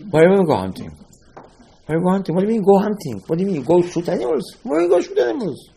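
can't believe anyone would hunt; incredulous. Acted. Incredulous questioning of hunter.

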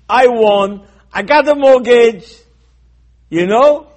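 loud, high crowing; triumphant boast. Retold or imitated. Cheater brags about getting a mortgage by lying, loud triumphant boast. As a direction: crow it out high and loud, imitating a cheat who won.

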